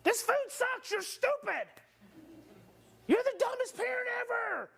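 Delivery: high-pitched bratty mock tantrum; acted child outburst. Child lashing out at parents, acted high angry outburst. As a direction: hurl it like an angry kid. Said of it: "to say to your parents".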